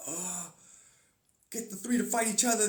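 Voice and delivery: usual speaking voice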